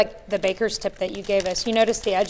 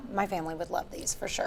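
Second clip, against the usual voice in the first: whispering; 3 semitones lower, 10 dB quieter than usual. Real, not acted. Tasting cookies, aside to host.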